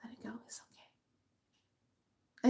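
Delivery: breathy ghostly whisper, very soft; soft reassuring ghost whisper; spirit whisper. Retold or imitated. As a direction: whisper it like an unseen presence. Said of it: "And I heard".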